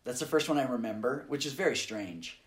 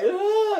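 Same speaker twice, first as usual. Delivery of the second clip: mock crying wail; 9 dB louder than usual. Imitating own crying now.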